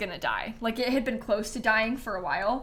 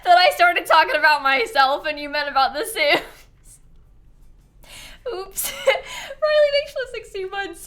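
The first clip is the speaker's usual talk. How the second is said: embarrassed laughing; 7 semitones higher, 5 dB louder than usual. Real, not acted. Embarrassed after a mix-up.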